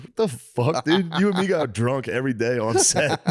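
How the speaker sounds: high-pitched mock outrage; retold incredulous comeback; incredulous protest. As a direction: call out a friend's act in disbelief. Retold disbelief at serious interview question.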